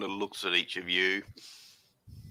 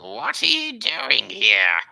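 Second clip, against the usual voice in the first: slow and booming, like a dragon; 3 semitones higher, 8 dB louder, slower than usual. Acted. GM voicing the dragon to the party, booming and slow. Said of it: "What's more like".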